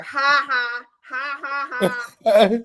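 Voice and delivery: reading out a fake ha ha; mock laughter. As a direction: spell out the laugh, sarcastic. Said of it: "the man laughing here".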